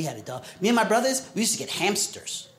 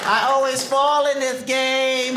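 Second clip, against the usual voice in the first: slow, mock coy girl; 6 semitones higher, slower than usual. Ugly girl faking a fall.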